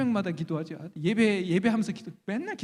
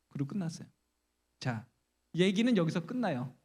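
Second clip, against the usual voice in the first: normal talking voice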